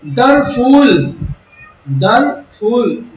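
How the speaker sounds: दर फूल slowly split, फूल held long; syllable-by-syllable scansion. Shown as a demonstration. Teacher counting off a metrical foot, दर फूल stretched. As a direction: chant out दर then a long फूल. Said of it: "दो ये हो गए".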